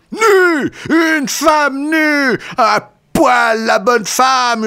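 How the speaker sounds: very high, drawn-out shouting; howling. A narrator's reading. Lecturer keeps on despite the slaps, howling high. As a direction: howl each phrase slowly at the top of your range. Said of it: "hurla Kanonov".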